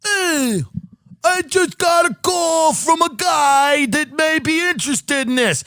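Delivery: high, slow, eager voice telling big news; excited realtor. Retold or imitated. Realtor tells his wife about the caller, high and eager. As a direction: gush the news up high and drawn out. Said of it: "he goes to his wife and he goes".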